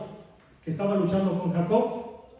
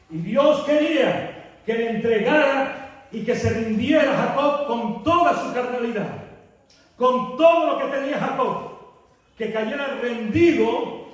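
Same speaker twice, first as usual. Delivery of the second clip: louder, higher, driving the point home; preaching at full force; 4 semitones higher, 5 dB louder than usual. Acted. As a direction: preach it to the congregation. (predicando con fuerza, más alto y más fuerte)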